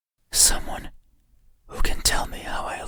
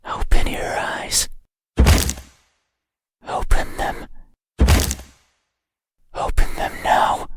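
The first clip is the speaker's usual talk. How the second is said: ordinary talk, nothing marked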